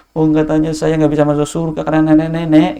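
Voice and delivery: lightly voiced grandmother's complaint; a hint of a sulky old woman; sulky grandma, lightly voiced.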